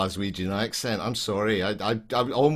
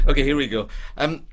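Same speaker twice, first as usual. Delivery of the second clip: plain reading voice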